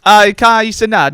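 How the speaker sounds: loud, slow mock Italian accent. Italian waiter greeting a supposed celebrity, Italian accent. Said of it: "Do the accent".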